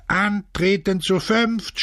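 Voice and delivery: slow, high drill call; high, stretched parade command; shouted order. A narrator's reading. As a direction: call it to the back, drawn out. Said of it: "schrie er nach hinten".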